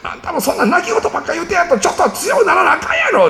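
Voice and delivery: a lightly high, nagging mother; scolding mom. Retold or imitated. A mother scolds her whining son, mock nagging. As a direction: chide him like a fed-up mom. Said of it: "お母さん言うてます".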